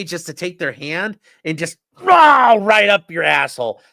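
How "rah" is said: loud growling rah as he lunges; mock aggressive roar. Mimicking a rough grab, a loud roar. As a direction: bark out a sudden shove noise. Acting out government grabbing you.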